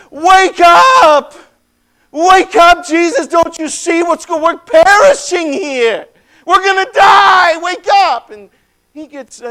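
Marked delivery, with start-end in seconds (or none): 0.1-8.3 loud, high shouts to rouse a sleeper; panicked shouting. Acted. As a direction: yell in terror over the storm. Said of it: "All of us are shouting".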